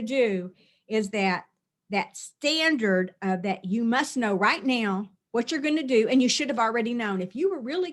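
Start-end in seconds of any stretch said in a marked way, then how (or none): none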